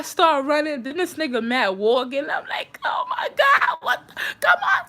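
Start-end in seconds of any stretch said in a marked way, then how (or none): 2.8-4.9 very high exasperated squeal, acted out; frantic retold cry. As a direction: urge a dawdler to run. Retold frantic urging to run.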